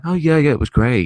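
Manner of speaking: mock cheerful answer to dad; fake happy kid. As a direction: sound chirpy to hide being upset. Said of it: "I was just being the happy kid".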